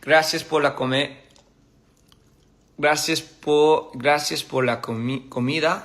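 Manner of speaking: sounding out gracias por la comida slowly; syllable by syllable, slowly; slow careful Spanish. Shown as a demonstration. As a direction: learner practising Spanish slowly. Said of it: "in español is".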